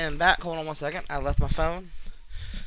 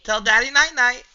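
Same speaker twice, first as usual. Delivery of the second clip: sleepy talk to pet; 4 semitones higher, 11 dB louder than usual. Real, not acted. Father says good night to daughter.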